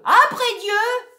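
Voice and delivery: high, loud, drawn out; shout. Acted. Actor adding a pious cry. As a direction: stretch it out grandly. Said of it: "Il ajouterait bien".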